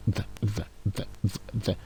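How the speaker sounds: a quiet, breathy "v v v" with the tongue between the teeth; soft th sound. Shown as a demonstration. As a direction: hiss out "v" softly with the tongue on the teeth. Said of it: "donc on fait f". (son th doux, langue entre les dents)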